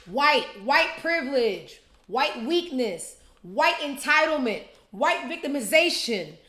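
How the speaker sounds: heated rant, each phrase hit hard. Real, not acted. Ranting list of accusations.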